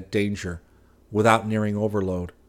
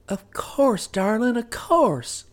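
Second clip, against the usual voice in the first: higher wife's voice, quick and soothing; 14 semitones higher, slower than usual. Acted. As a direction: hush him with gentle reassurance. Wife figure voiced.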